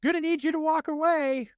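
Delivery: high, careful hey-man voice; nervous nice-guy voice telling a stranger to back off; meek polite confrontation. Retold or imitated. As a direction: ask a knife guy to leave politely. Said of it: "That's me".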